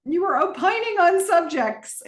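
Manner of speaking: high, amused parroting; mocking quote. Commentator mocking Gordon's fancy word, laughing. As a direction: poke fun at the word. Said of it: "Who uses opining in the middle of a fight?".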